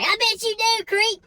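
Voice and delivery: mother yelling at the creep to back off; outraged mom. Retold or imitated. Mom screaming at the stranger to get away, outraged high yell. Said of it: "she's like".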